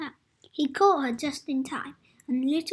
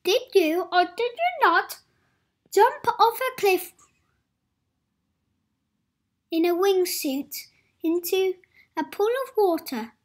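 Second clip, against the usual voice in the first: loud, higher grilling; stern accusing question; 5 semitones higher, 6 dB louder than usual. Acted. Little Miss Safe grilling Adventurous, stern accusing. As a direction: interrogate him sharply.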